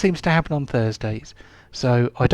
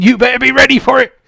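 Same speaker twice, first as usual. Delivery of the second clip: higher, louder, fast warning; dramatic warning shout; 10 semitones higher, 7 dB louder, faster than usual. Acted. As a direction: shout the warning to scare them. Pirate warns of the beast.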